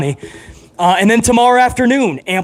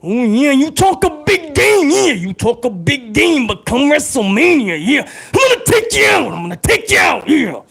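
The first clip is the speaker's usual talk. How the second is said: hammed-up high Macho Man snarl, building to a shout; 6 semitones higher than usual. Streamer back as Macho Man, shouting a hammed-up threat. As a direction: growl the threat like an over-the-top wrestler. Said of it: "That's Macho Man and Stone Cold".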